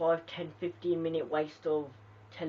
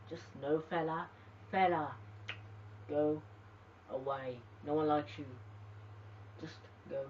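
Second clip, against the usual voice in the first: dismissive; 6 dB quieter than usual. Real, not acted. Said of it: "Sheamus, you're going downhill".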